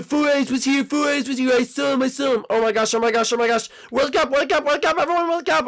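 high-pitched, repeated panicky cries; frantic alarm. Acted. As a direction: rouse the whole house in a panic. Frantically waking everyone after break-in.

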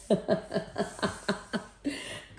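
long run of voiceless ha-ha; long chuckle. Real, not acted. Reader laughing as the reading starts, long chuckle. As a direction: laugh in quick, airy pulses.

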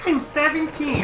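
put-on high child voice. Voicing child answer.

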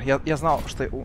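ordinary delivery

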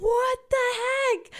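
high, drawn-out exclamation; hammed-up, high-pitched outrage; mock indignant disbelief. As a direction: squeal it slowly in exaggerated disbelief. Acts out her indignant disbelief.

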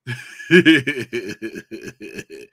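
long rolling chuckle; amused laugh. Real, not acted. Streamer laughs after teasing a listener in chat, long chuckle. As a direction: laugh at your own teasing.

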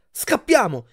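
loud, high urgent cry; shouted alarm. A narrator's reading. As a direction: yell to your friends to flee. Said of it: "gridai ad Annabeth e Grover".

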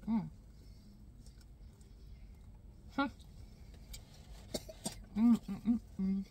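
muffled hums while chewing; mouth full, chewing. Real, not acted. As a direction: lips closed around food. Seen 0: Bites wrap at +0.2–1.3, then chews with full mouth, licking fingers.

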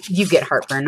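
loud, fed-up mock surrender to the dog; exasperated give-up. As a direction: throw up your hands at the dog. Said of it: "I'm like".

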